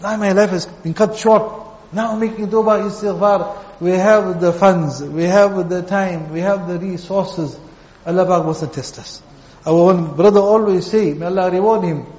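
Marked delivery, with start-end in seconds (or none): 3.8-7.6 each have leaned on, slowly; have, have, have, drawn out and weighed; slow stressed have. Real, not acted. Preacher counts blessings, slow stress on have.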